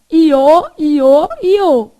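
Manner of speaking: iô repeated loud, oral, not nasal; oral iô demo. Shown as a demonstration. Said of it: "É nasal?".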